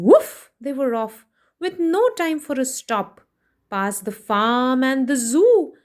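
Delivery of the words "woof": barking woof. Acted. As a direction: bark it out like the dog. Dog barks, they run.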